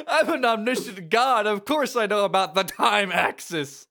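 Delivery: high, loud and lofty, like a know-it-all deity; pompous divine proclamation; smug god voice. Acted. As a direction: boast as an all-knowing god. Joking in character as Joshua.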